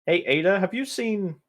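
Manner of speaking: ordinary talk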